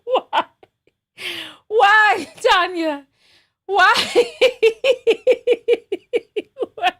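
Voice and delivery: high, loud words breaking into giggles; helpless laughing. Real, not acted. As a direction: wail it through real laughter. Questions herself while cracking up.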